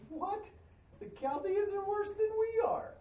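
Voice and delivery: high mock-shocked protest; incredulous squeal. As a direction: squeak it like you can't believe your ears. Habakkuk reacting to God's plan.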